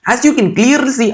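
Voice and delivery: high-pitched, hammed-up Indian accent. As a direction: ham up a comic Indian accent. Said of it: "I don't have an Indian".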